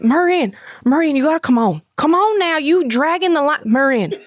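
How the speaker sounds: high, hollered Louisiana accent imitation; Louisiana stepdad. Stepdad hurrying mom through the haunt, Louisiana accent. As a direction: call her along in a hammed-up Louisiana accent. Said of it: "he's from Louisiana".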